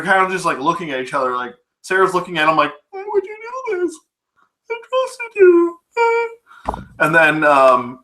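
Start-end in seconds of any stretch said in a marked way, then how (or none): 2.9-5.8 much higher, hurt mock plea, slowing at the end; betrayed plea. Sarah staring at Arnold after the shooting, high hurt plea. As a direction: plead like someone just betrayed.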